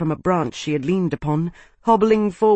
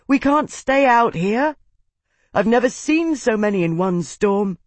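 higher, pressing voice; urgent warning; 6 semitones higher than usual. A narrator's reading. Tavi warns the slave about the storm, raised urgent voice. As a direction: warn urgently without shouting.

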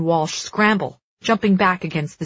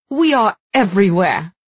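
defiant laugh through pain; laughing defiance; 3 semitones higher, 7 dB louder than usual. A narrator's reading. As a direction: spit it out with a laugh. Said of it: "she laughs".

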